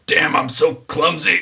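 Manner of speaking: high, loud, slow self-reproach; pained clumsy chef. Acted. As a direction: curse yourself while hurt from a fall. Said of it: "he's, like, holding his side".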